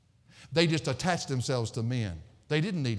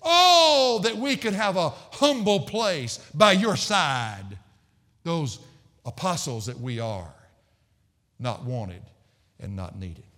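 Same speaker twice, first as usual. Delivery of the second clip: sarcastic; 4 semitones higher, 6 dB louder, slower than usual. Acted. Preacher voicing Paul, unwanted apostle, to Corinth, sarcastic. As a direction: open on a big high "Oh", then sink away. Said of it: "it's almost like Paul sarcastically says".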